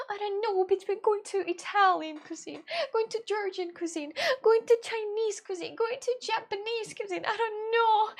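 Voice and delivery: mock helpless indecision over cuisines; squeaky voice of someone who can't choose; indecisive whine. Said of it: "And I'm like".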